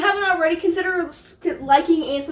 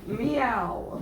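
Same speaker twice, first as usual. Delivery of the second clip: cat meow; 3 semitones lower, 7 dB quieter than usual. Pretend. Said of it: "I like meowing".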